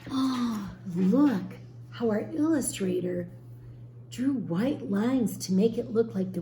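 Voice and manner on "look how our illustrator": ordinary delivery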